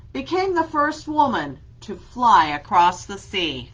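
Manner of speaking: lively read-aloud with a steady rhyme beat; reading to children. Acted. Teacher reads an alphabet book to her class, lively and rhythmic.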